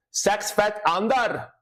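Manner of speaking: high, slow Scottish "Sex fat under" for six feet under; Scottish accent. Shown as a demonstration. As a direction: drawl "Sex fat under" as a Scot would. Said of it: "with the Scottish accent".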